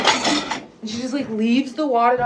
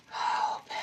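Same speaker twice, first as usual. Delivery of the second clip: a breathless whisper at the bag; whispered to the stuck bag; whispered plea; 7 dB quieter than usual. Real, not acted. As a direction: beg it under your breath. Fighting to open a bag.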